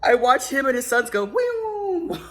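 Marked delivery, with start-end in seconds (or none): 1.3-2.2 high, drawn-out mock whoosh; imitated whoosh. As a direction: make a high whizzing-past noise. Imitates them as car passes.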